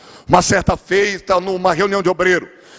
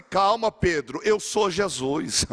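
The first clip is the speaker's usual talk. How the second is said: calm reassurance; 7 dB quieter, slower than usual. Acted. Jesus calming Pedro.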